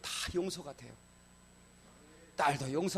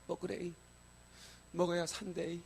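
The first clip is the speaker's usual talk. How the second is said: imitated Gyeongsang accent, softly higher; Gyeongsang dialect; 5 semitones higher, 6 dB quieter than usual. Older co-worker urging sick youth to eat porridge, Gyeongsang accent. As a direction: quote her in gentle Gyeongsang dialect. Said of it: "경상도 말로".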